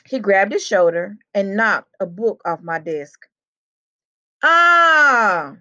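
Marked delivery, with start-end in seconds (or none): 4.4-5.6 high, loud cry of fake pain; loud acted yelp; shout. A narrator's reading. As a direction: yell out as if badly hurt. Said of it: "he shouted".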